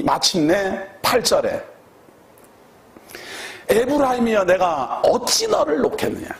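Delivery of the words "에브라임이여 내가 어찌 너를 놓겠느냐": pleading voice of God, pitched higher; a touch of God's anguished plea; voicing God. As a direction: cry out as God who cannot let go.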